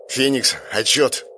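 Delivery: slow, effortful radio order; strained, as if in pain. A narrator's reading. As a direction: call for a report through pain. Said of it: "натужный голос Райана, словно ему было больно".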